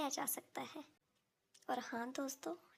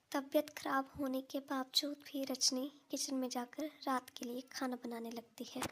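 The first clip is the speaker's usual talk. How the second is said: plain reading voice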